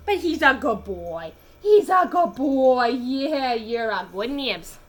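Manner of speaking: slow, lightly cooed praise; pet talk. Real, not acted. Praising her cat, slow and cooing.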